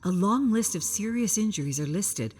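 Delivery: ordinary delivery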